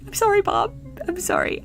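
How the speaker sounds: high, soft voice for a pet; small, sweet high-pitched sorry; tender apology. Real, not acted. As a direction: coo an apology to Bob. Apologizes to Bob after yelling.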